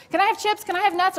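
put-on hungry child pestering a parent cooking dinner; begging kid. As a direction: nag for snacks like a starving kid.

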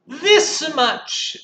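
high, loud and eager; excited little bunny boast; little hare. Acted. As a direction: show off proudly with arms wide. Little hare stretching arms.

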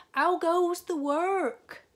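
high, loud, slow bellow from bed; lazy farmer calling. A narrator's reading. Lazy farmer calling from bed to the duck, high loud bellow. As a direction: boom it out like a lazy boss. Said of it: "called the farmer".

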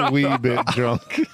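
put-on slow, laughing wee bit drunk with rising wee; laughing Irish lilt. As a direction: savor the Irish slip through laughter. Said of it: "Irish mixed in".

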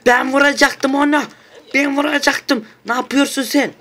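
protesting that his kill was stolen; shrieking outrage. Real, not acted. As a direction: squeal in furious disbelief.

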